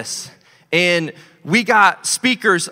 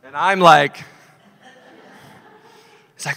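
laughing; 3 dB louder than usual. Real, not acted. Laughing while telling the story.

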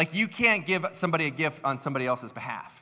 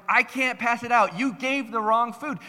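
high, raised-voice mock argument; heated retold comeback, pitched up; getting so mad; 10 semitones higher, 4 dB louder than usual. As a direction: imitate an exasperated, angry customer. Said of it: "they were, like, getting so mad".

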